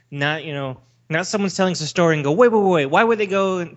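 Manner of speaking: ordinary delivery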